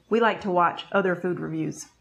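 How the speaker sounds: normal talking voice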